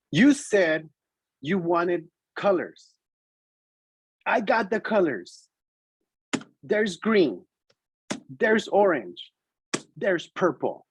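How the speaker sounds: imitated kid making a deliberate case; very precise child. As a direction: spell it out pointedly, one piece at a time. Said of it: "very precise".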